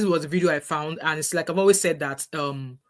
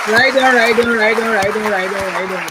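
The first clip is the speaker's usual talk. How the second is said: loud, high shouted approval; cheering; 4 semitones higher, 7 dB louder, slower than usual. Real, not acted. Cheering the SNP speaker's words, loud excited approval. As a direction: whoop along like an excited crowd.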